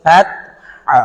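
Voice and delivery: slow, drawn-out tajweed recitation; Quran recitation. Shown as a demonstration. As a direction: recite with long held vowels. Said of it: "recites verse for class".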